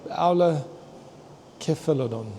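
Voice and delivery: Aulocephalodon drawn out syllable by syllable; slow careful attempt. Real, not acted. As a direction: painstakingly sound out Aulocephalodon. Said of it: "I can't say it".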